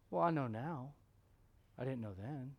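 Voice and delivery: a touch of goofy Arkansas twang; goofy Arkansas accent. Retold or imitated. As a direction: mimic an Arkansas missionary, lightly. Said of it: "Arkansas accent".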